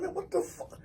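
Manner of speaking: very high, soft disbelief; mock bewilderment. Man with hurting back questions cousin, high soft bewilderment. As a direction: squeeze out a baffled protest, high and small. His bewildered reaction, retold.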